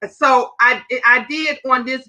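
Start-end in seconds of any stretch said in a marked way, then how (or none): 0.0-0.1 high mock gossip; mocking haters. Mimicking haters gossiping about playing the video. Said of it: "You know what the haters try to do".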